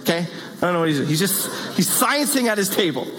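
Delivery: ordinary talk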